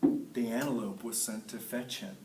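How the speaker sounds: ordinary delivery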